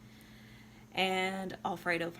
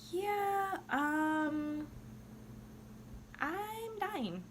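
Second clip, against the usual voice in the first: high voice; 10 semitones higher, much slower than usual. Retold or imitated. Mock casual dying Violetta.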